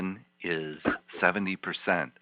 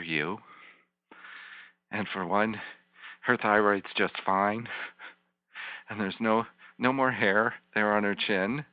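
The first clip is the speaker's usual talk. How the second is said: guided visualization; 3 semitones higher than usual. Acted. Therapist guiding the client to hug her younger self, tender. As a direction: paint a safe, loving picture for her.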